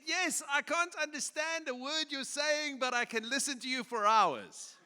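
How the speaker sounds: put-on high, slowed elderly lady voice breaking into a laugh; the sweet old lady confessing she can't understand a word, laughing at the end. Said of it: "she was coming with a walker".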